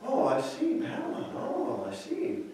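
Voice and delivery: hushed, high mock admiration; sarcastic. Isaiah coaxing the king, quiet sarcastic admiration. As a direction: softly play along, then press. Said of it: "by using that sarcasm".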